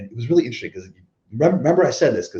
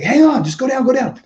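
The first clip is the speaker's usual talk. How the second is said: high, louder command to stay down; urgent order; 6 dB louder than usual. Retold or imitated. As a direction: bark it at him mid brawl. Voices the other wrestlers commanding.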